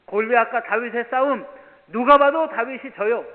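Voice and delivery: loud, rising sermon climax; preaching at full force. Acted. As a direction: preach it out to the congregation. (힘차게 외치는 설교)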